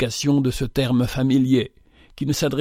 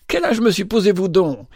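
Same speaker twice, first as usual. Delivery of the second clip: forced laughing exclaim; 6 semitones higher than usual. A narrator's reading. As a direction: brush him off with a forced lightness. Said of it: "s'écria Rachel avec un rire forcé". (exclamation légère au rire forcé)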